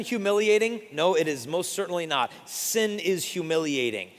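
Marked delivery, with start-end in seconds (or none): none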